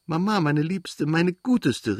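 bursting with joy at mother's return; joyful cry. A narrator's reading. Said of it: "rief er".

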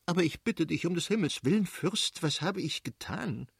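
high, distressed pleading; nearly weeping. A narrator's reading. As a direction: plead as if tears are close. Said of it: "Die Fürstin weinte beinahe".

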